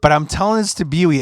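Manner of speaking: ordinary talk, nothing marked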